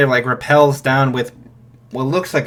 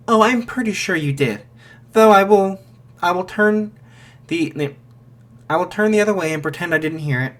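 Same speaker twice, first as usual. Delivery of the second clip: higher, wry, knowing reply; dry teasing; 5 semitones higher, faster than usual. Acted. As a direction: let him off with a sly, higher tease. Gate voice teasing the hero.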